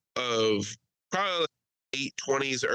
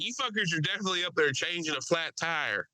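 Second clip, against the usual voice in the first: higher-pitched heckling passenger imitation; mock heckling; 5 semitones higher than usual. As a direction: needle the crew as the loudmouth passenger. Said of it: "now he's just heckling the".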